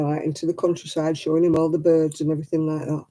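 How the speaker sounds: ordinary talk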